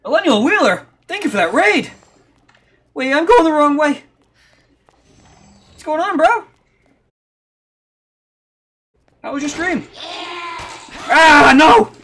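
high, loud streamer shout. Real, not acted. Shouting thanks and greetings at a stream.

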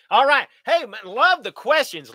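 high, loud "All right."; upbeat. Real, not acted. Pumping up viewers, a loud, high "All right.". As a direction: perk up, burst back in.

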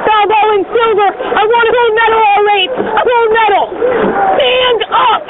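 all-out finish calls to the crew; rowing coxswain calls. Acted.

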